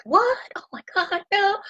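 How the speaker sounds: jumps way up in pitch, imitating a shocked parent; a hammed-up gasp of parental panic; mock freak-out. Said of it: "Most parents are gonna kind of freak out".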